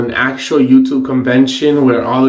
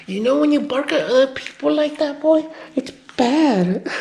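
gentle scolding; 10 semitones higher, 5 dB quieter than usual. Real, not acted. Owner to dog about barking, high gentle scolding. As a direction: chide a pet in a high, soft voice.

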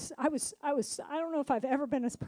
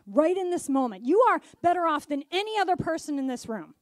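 bold declaration; 2 semitones higher, 9 dB louder than usual. Retold or imitated. John's bold words to grieving wife.

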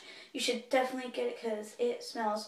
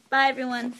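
ordinary talk

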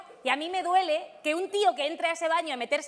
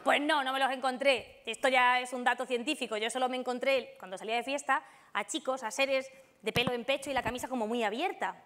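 usual speaking voice

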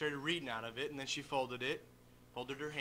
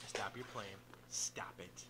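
whispered scolding; 6 semitones lower, 7 dB quieter, slower than usual. Real, not acted. Father quietly telling his child off mid-story, whispered. Aside to child while testifying.

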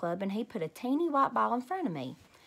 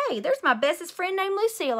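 high, excited yell to a friend; 8 semitones higher, 4 dB louder than usual. Acted. Junie B. spots her best friend and yells, high and thrilled. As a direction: shout across the lot, thrilled. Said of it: "I yelled".

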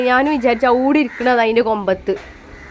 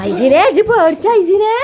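high, loud, slow teasing question; mock-scolding, singsong tease; playful teasing; 8 semitones higher, 5 dB louder than usual. Real, not acted. Teasing the child about fear.

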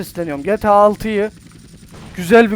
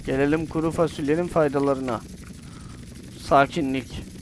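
lower, softer mock-serene voice; forced calm; 5 semitones lower, 6 dB quieter than usual. Gamer faking serenity after calming music, soft mock calm. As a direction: pretend to be perfectly calm. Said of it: "artık sakinim".

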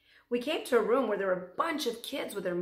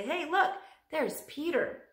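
excited; 7 semitones higher than usual. Pretend. Friends excited seeing him.

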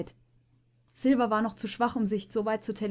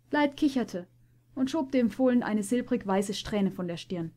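ordinary delivery, nothing marked

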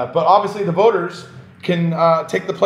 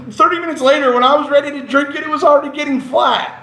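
higher and louder, building outrage; worked-up rant; 8 semitones higher, 3 dB louder than usual. Real, not acted. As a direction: get more and more worked up. Indignant soda story.

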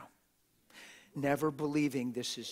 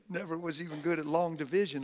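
ordinary talk

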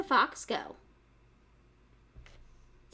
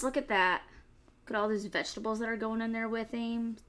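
usual speaking voice

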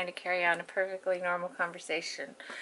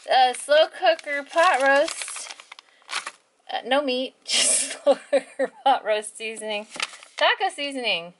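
laughing; 6 semitones higher, 7 dB louder than usual. Real, not acted. Laughing at own sleep shopping list.

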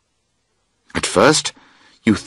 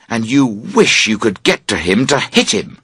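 ordinary delivery

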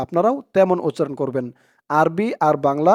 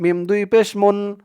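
normal talking voice

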